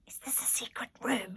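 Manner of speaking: breathy hushed question; whisper. A narrator's reading. Alfred asks Nanny in the hidden room, whispering. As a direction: whisper it so no one hears. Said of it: "he whispered".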